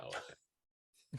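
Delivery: breathless, slow, whispered "oh, okay"; deflated whisper. Retold or imitated. Told by friends not to watch, a slow deflated whisper. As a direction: sigh it out, disappointed. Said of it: "And I'm like".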